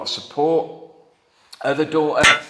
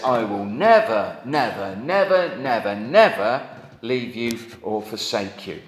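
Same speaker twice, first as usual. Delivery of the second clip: each never struck hard, building insistence on never; emphatic repeated never; 2 semitones lower than usual. Shown as a demonstration. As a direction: hammer every never home. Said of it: "the more negatives ... the more emphatic".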